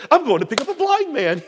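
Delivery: high-pitched giddy announcement; all excited. Retold or imitated. Husband tells woken wife his errand, all excited. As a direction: re-enact breathless eagerness, pitch up high. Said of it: "I was all excited".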